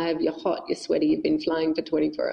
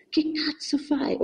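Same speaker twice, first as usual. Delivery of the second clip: a light Italian exasperated outburst, slow; Italian swearing; 9 semitones higher, slower than usual. Retold or imitated. Partner reacting to the plan, light Italian exasperation. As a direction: toss it off in Italian like a fed-up partner. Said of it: "I just swore in Italian".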